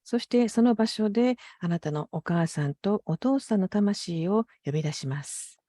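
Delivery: usual speaking voice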